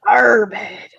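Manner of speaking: laughing pirate "our bed". Pretend. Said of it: "sounds like a pirate word".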